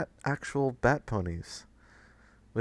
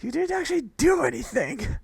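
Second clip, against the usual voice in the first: mock shout; 14 semitones higher than usual. Shouting at Spike in jest.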